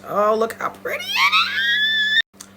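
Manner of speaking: very high, drawn-out squeal of delight; gushing that shoots up into a long screech. Real, not acted. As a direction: screech it, stretching the end way up high. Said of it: "'cause I screech a lot".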